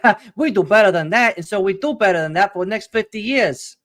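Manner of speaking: exaggerated fake Chinese accent, high and loud; mock Chinese accent. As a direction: put on a hammy Chinese accent. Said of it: "Why is your Chinese voice Italian?".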